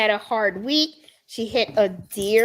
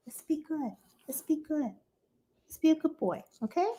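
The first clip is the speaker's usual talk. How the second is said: soothing dog talk; 6 semitones higher, 9 dB quieter, faster than usual. Real, not acted.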